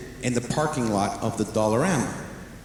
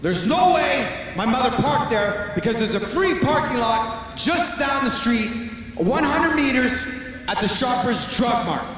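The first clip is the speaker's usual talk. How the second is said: raised voice; 8 semitones higher than usual. Retold or imitated. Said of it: "I call and said".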